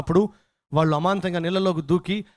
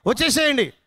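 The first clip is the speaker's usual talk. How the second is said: high, urgent pleading call; wife's shouting; 5 semitones higher than usual. Acted. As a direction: call out as a wife begging her husband back. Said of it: "భార్య అరుస్తుంది". (భార్య కేకలు వేస్తూ పిలుపు)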